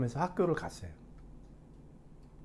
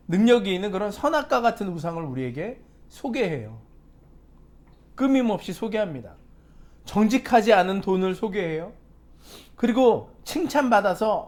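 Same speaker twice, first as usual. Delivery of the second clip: loud, higher pulpit preaching; forceful preaching; 4 semitones higher, 5 dB louder than usual. Acted. Pastor warning the congregation about idols, preaching loudly. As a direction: drive the sermon home to the congregation.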